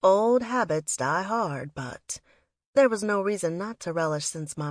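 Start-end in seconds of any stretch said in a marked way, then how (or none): none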